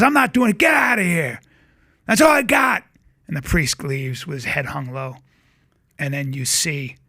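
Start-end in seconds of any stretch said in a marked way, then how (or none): 0.0-2.8 loud high bark, throwing him out; angry refusal shout. Retold or imitated. Condemned gangster shouting the priest out, furious. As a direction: yell him out of the cell.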